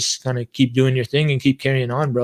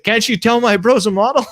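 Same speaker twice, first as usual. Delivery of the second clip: mock indignation; 9 semitones higher, 4 dB louder than usual. Retold teasing jab at border officer.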